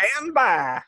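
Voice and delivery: "Pecan pie" in a stretched, hammed-up Southern drawl; mock Southern drawl. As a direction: drawl out "Pecan pie" like a Tennessee local, teasing. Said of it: "that drawl".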